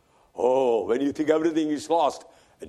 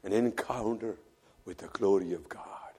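much softer, whispered echo of the phrase; whispered repeat; 4 semitones lower, 6 dB quieter than usual. Shown as a demonstration. Preacher repeating the phrase to the congregation, now whispered. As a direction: hush it, letting it sink in. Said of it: "repeated as whisper".

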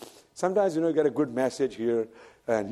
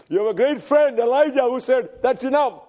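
raised, ringing preacher's voice; preaching at full force; 12 semitones higher, 7 dB louder than usual. Acted. Preacher retelling Elijah's flight to the congregation, preaching at full force. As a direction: proclaim it to the congregation.

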